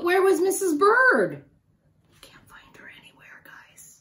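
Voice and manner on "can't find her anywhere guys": hushed secret whisper. Real, not acted. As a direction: lean in and whisper it. Story suspense to child.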